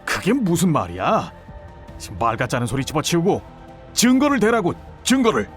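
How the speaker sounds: rising to a high, agitated demand; cornered man lashing out for proof; indignant outburst. Acted. (흥분해서 높은 목소리로 다그치듯)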